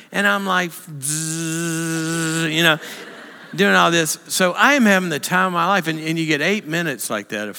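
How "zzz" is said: playful zzz buzzing sound like gliding through air; buzzing flying noise. Retold or imitated. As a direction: hum the rush of flying. Imitating the feel of flying.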